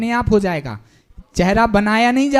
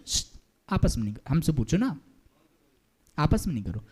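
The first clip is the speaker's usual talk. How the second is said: much softer, lower hush at students; 7 semitones lower, 9 dB quieter, faster than usual. Real, not acted. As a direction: quietly shush the talkers. Hushing chatting students. (बात करते छात्रों को धीमे से चुप कराना)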